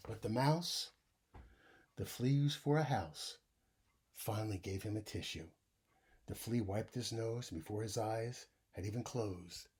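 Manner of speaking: put-on lively read-aloud for young kids; story reading. A narrator's reading. Teacher reading a bedtime story to the class, animated. Reads picture book aloud.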